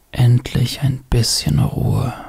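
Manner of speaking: slow, breathy whisper of relief; relieved whisper. Real, not acted. Relief once rain sounds start.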